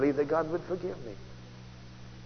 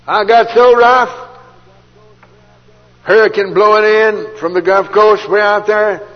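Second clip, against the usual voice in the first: booming preaching; 7 semitones higher, 15 dB louder, slower than usual. Real, not acted. Preacher thundering the hurricane story.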